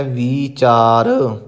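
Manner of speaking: ਵਿਚਾਰ said with a long ee after the v; long ee vowel. Shown as a demonstration. Teacher corrects the vowel for students, careful pronunciation. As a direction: stretch the vee of ਵਿਚਾਰ. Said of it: "ਵਾਵੇ ਨੂੰ ਬਿਹਾਰੀ ਲਾ ਕੇ ਉਚਾਰਨ".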